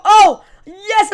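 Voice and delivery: loud, high cheer; triumphant shout. Real, not acted. Gamer surviving a fight, cheering loudly.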